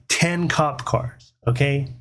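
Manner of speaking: plain reading voice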